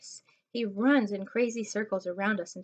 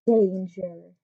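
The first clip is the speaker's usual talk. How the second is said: ominous sign; 8 dB louder than usual. Acted. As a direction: read it like a big warning. Reading a warning sign.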